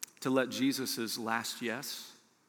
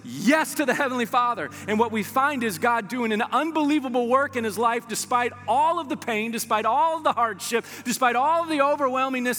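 higher, driving preacher voice building up; preaching at full force; 8 semitones higher, 4 dB louder than usual. Acted. Preacher rallying the church through Paul's hardships, full force. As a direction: preach it, climbing with fire.

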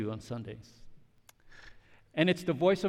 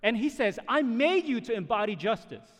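raised, emphatic preacher's delivery; impassioned preaching; 6 semitones higher, 7 dB louder than usual. Real, not acted. Preacher proclaiming Jesus's final word over others, impassioned. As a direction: drive it home to the congregation.

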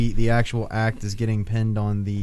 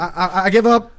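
high, loud, stammering give-up; hammed-up terrified robber; mock surrender; 8 semitones higher, 6 dB louder than usual. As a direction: cower and blurt out your surrender. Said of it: "Just surrender".

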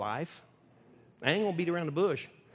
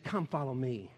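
gentle Jesus voice; 8 semitones lower than usual. Acted. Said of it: "He said".

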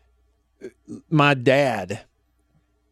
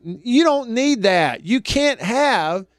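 put-on higher, very slow scolding child voice; stern little daughter; 9 semitones higher, much slower than usual. Dad imitating daughter scolding him over gas station chicken, high and slow. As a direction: imitate a bossy eight-year-old laying down the law. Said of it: "almost in this tone".

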